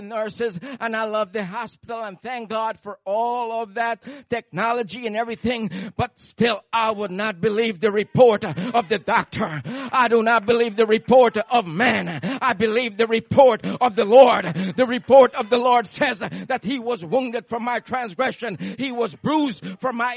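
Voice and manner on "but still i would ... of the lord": loud rising preacher cadence; full-force preaching. Acted. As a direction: preach it out, building to a roar.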